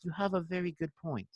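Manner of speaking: put-on soft, measured British accent; Sammy. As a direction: imitate a British friend conceding gently. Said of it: "like, his British accent".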